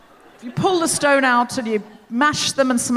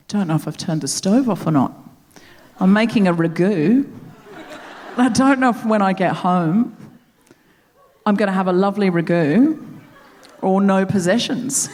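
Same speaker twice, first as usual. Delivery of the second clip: put-on Uber driver's lower-pitched Australian accent; 5 semitones lower than usual. Australian Uber driver tells his passengers about his ragu, in an affectionate Australian accent. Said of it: "I'm going to do the accent".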